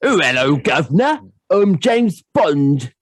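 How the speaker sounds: high, slow, hammed-up mock cockney accent; bad Mary Poppins cockney accent. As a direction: overdo a fake cockney chimney-sweep accent.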